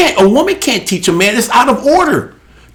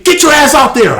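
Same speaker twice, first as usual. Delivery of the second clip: coach's order; 7 semitones higher than usual. Retold or imitated. Retelling his football coach's orders.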